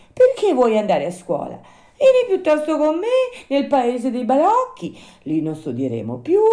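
loud, plaintive puppet with a swinging pitch; whining Pinocchio. Acted. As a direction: make excuses like an aggrieved puppet. Narrator voices Pinocchio explaining.

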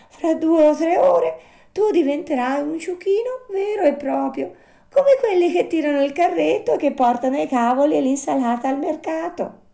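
very high little-animal voice; explaining marmot. Acted. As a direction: pronounce the verdict in a tiny, high voice.

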